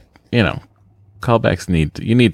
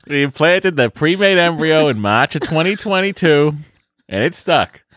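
put-on Boston accent; 3 semitones higher than usual. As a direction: read it like a broad Bostonian. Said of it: "the accent from my, uh, our home".

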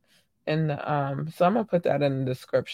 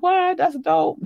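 high-pitched surprised reaction, less pumped; imitated mild excitement; 3 dB louder, slower than usual. Host imitating Boo hearing the news, milder excitement. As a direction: react brightly, short of a full squeal. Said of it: "He don't be as pumped as I do".

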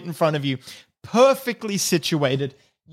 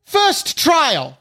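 proclaiming; 3 semitones higher, slower than usual. Acted. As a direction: announce it grandly to a crowd. Kobold announces the trial.

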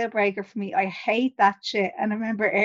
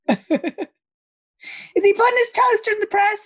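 laughing disbelief; 11 semitones higher than usual. Real, not acted. Incredulous laughing question.

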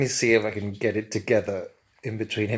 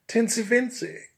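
higher, mock-grand hello; pompous expert greets a camp hairdresser; Bunfrey greeting; 12 semitones higher than usual. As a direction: hail an old acquaintance grandly. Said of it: "my honeyed, liquid, and highly well-pronounced tones".

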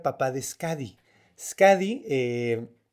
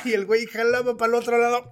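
ordinary talk

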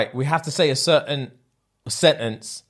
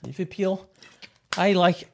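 higher trial Scouse; Liverpool accent; 5 semitones higher, 4 dB quieter than usual. Shown as a demonstration. Podcaster warming up to show his friend, Liverpool accent. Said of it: "We'll go Liverpool".

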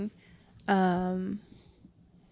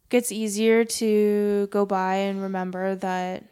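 normal talking voice

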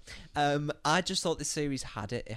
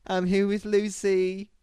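higher, louder, slow announcement; an overblown reality TV line; mock dramatic reveal; 9 semitones higher, 9 dB louder, slower than usual. Quoting a show line.

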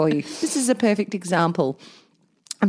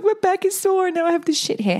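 mock whiny complaint; 10 semitones higher than usual. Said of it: "I'm like".

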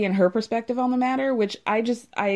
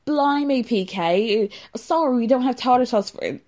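hammed-up British impression, pitched up; bad British accent; 4 semitones higher than usual. [name] mimicking a British person at dinner, hammed-up British accent. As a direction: put on an inexact British accent. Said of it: "like, impression of a British person".

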